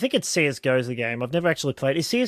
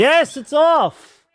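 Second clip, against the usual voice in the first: excited; 12 semitones higher, 7 dB louder, much slower than usual. Real, not acted. Excited announcement.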